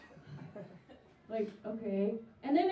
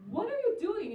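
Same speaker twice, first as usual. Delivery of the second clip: confused; 9 semitones higher, slower than usual. Retold or imitated. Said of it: "I was confused".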